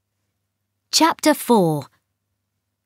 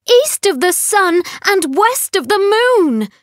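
crying out; 11 semitones higher than usual. A narrator's reading. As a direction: call it out to the empty world. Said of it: "Astrid cried".

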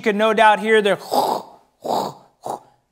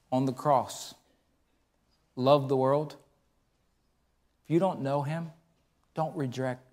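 low, much softer earnest sermon voice; intimate preaching; 7 semitones lower, 8 dB quieter than usual. Acted. Preacher softly urging congregation to love like Christ. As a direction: draw them close with quiet conviction.

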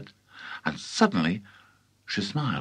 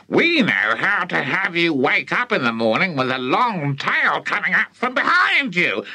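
put-on high, gloating old witch; sinister witch voice; 8 semitones higher, 4 dB louder than usual. A narrator's reading. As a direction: menace the boy with relish. Grandma threatens George.

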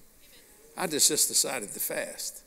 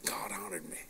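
much softer, whispered testimony; whispered praise; 9 semitones lower, 12 dB quieter than usual. Real, not acted. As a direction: breathe it out in awe.

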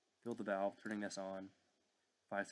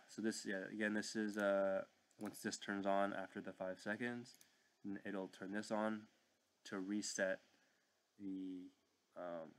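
usual speaking voice

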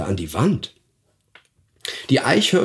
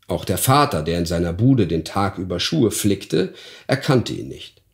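ordinary delivery, nothing marked

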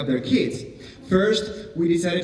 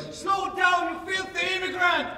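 much higher, strained yell without real volume; mock heckler's yell; 4 dB quieter than usual. Heckler to fast-talking immigrant comic, high mock yell. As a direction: yell it thin and high, as from the crowd. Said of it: "just yell".

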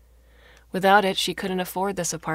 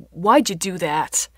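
louder, drawn-out snapped question; angry snap; 8 dB louder, slower than usual. A narrator's reading. Father demanding why his daughter pulled the cord, angry snap. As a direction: demand it angrily, slowly and loudly. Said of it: "her father snapped".

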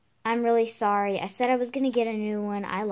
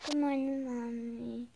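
soft doll voice; 10 dB quieter, slower than usual. Pretend. Answering as the doll.

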